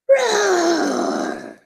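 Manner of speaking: high crying dragon roar. Acted. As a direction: let out a storybook dragon roar. Dragon roars as Boy runs in.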